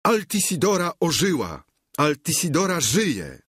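high, excited proclamation; crowd's joint cry. A narrator's reading. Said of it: "chórem zawołali".

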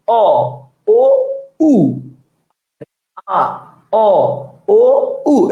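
অ, ও, উ one by one, lips rounding further each time; rounded vowel demonstration. Shown as a demonstration. Teacher shows pronunciation becoming round, stepwise অ-ও-উ demonstration. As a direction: isolate each vowel, reaching fully rounded উ. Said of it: "উচ্চারণটা গোল হয়ে যাবে".

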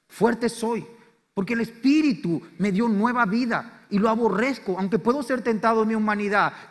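loud, high, fervent declaration; weak one declaring strength. Acted. Preacher urging the weak to proclaim strength, loud and high. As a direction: let the weak shout his strength. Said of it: "diga al débil".

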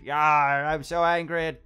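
hammed-up angry roar, drawn out; slow, loud cartoon rage; mock angry. Player voicing his angry mad scientist figure, mock angry. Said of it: "Angry teeth".

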